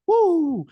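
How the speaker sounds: loud, high "Woo!" with a sideways punch; celebratory whoop. Shown as a demonstration. Guest showing the podcast host his celebration move, loud whoop. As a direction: whoop it out like a victory cry. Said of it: "you'll notice I do this move".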